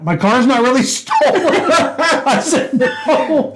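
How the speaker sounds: words breaking into real laughter; laughing retelling. Real, not acted. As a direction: struggle through the story while laughing. Teller cracks up retelling exchange.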